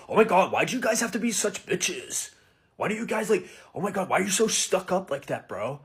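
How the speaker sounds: mock indignant American bar guy; rejected American guy. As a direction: mimic an American bro turning on the girls. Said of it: "the switch up was insane".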